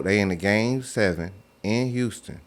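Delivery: normal talking voice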